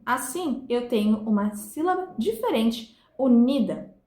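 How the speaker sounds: ordinary talk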